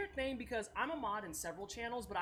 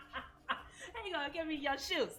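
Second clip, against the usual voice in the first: laughing through the words, slower; 8 semitones higher, 6 dB louder, slower than usual. Real, not acted. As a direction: giggle out the question in disbelief. Laughing at a chatter's shoe joke.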